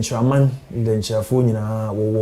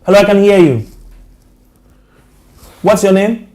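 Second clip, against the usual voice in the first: raised voice over a bad line; to someone hard of hearing; 7 semitones higher, 6 dB louder, faster than usual. Real, not acted. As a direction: call out clearly to a faint caller. Caller barely audible on phone.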